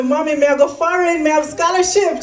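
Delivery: high-pitched, eager patois announcement to Mommy; excited retelling. Retold or imitated. Daughter running home to tell Mommy about the scholarship, excited.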